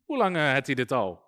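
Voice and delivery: low-pitched, casual Brabant dialect; lightly put-on Brabant Dutch; Brabant accent. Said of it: "Dat is Brabant".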